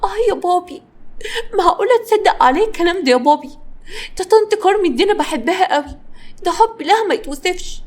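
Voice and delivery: high-pitched play-acted wounded innocence; feigned shock and hurt. A narrator's reading. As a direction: lay on fake shock for daddy. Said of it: "جانا بدأت تمثل الصدمة والحزن".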